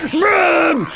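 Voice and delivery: high loud triumphant yell; triumphant shout. Real, not acted. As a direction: shout it as the blow lands. Big hit on enemy.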